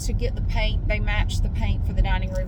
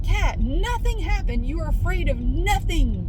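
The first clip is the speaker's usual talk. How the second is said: exasperated scolding; 6 semitones higher than usual. Retold or imitated. Mock scolding the scared cat.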